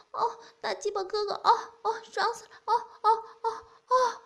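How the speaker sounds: high, loud moans and cries; woman character moaning loudly. Acted. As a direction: cry out high and loud, lost in pleasure.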